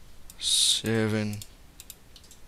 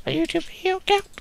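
very high, fast squeak; squeaky falsetto; much faster than usual. Shown as a demonstration. Vlogger repeating the reveal in a squeaky falsetto. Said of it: "repeated takes".

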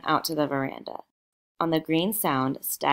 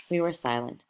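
ordinary delivery, nothing marked

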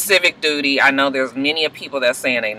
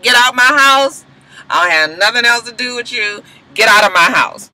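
angry Bonnie; 6 semitones higher than usual. Retold or imitated. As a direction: order him out of the house. Bonnie ordering him out.

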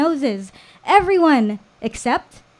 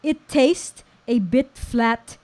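put-on slow, Filipino-accented punchline; deadpan Lolo with a Filipino accent; Filipino grandfather; slower than usual. A narrator's reading. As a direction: deliver the joke slowly, in a Filipino accent. Lolo's joke, acted in his voice.

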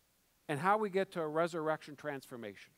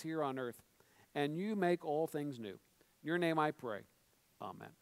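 measured, lowered praying voice; public prayer; 4 semitones lower than usual. Acted. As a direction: lead the congregation in reverent prayer. Leads congregation in prayer.

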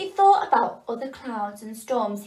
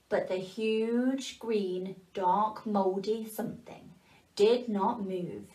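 softer and very slow, drawn out; slow ominous narration; 4 dB quieter, slower than usual. A narrator's reading. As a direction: let it loom, slowly and quietly. Slow heavy narration of the cloud.

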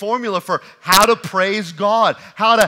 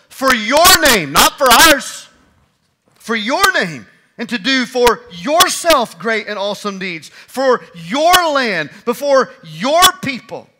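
louder, emphatic preaching; preaching with force; 4 semitones higher, 7 dB louder than usual. Acted. Preacher stressing God's name to his congregation, forceful. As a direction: press each "your" home, building.

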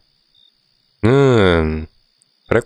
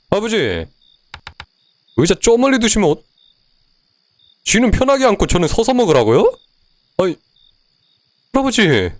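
whiny complaint; 12 semitones higher than usual. Real, not acted. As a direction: protest like you've been wronged. Complaining about the chair. (억울하게 따지는 높은 목소리)

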